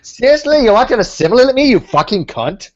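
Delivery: high, loud mock outrage; Stizer. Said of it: "a bit like Stizer".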